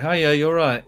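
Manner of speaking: cheery doorstep greeting in mock British; fake British accent. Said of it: "I did a fake accent".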